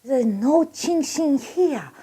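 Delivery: high, slow mock accent, starting hushed and building; secretive Chinese waiter. Waiter sends a customer off to Chinatown, high conspiratorial mock accent. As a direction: confide it in a hammed-up Chinese accent, drawn out. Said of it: "The guy goes".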